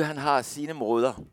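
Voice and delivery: normal talking voice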